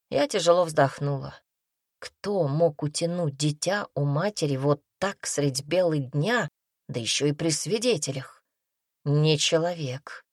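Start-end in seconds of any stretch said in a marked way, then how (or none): none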